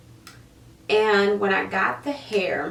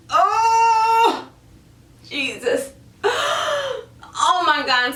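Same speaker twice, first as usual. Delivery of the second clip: real anguished cry; 13 semitones higher, 5 dB louder, much slower than usual. Real, not acted. Reliving disgust, crying out.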